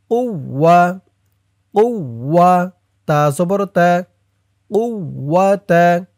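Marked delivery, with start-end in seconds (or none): none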